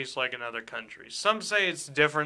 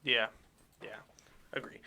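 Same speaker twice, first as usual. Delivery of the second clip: ordinary talk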